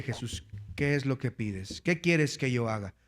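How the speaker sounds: low, softened voice; quiet question. Acted. Jesus asking the blind man his wish, quietly. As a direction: drop right down and ask softly.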